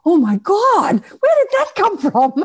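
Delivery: high, loud amazed outburst; astonished. Retold or imitated. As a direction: gasp it out, breaking into a laugh. Retells her astonishment at channeling accent.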